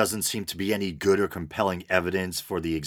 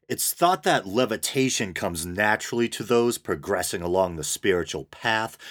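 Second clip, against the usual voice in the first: ordinary talk, nothing marked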